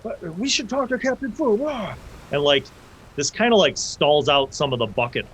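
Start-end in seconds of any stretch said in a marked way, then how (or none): 0.0-1.9 mock shouting. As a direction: call out in alarm as a high, thin crowd voice. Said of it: "they're shouting and they're like".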